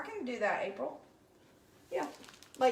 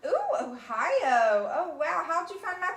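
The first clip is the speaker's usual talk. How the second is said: slow, drawn-out laughing "Ooh"; delighted; 5 semitones higher, 6 dB louder than usual. Real, not acted. Viewer from Ohio greeted.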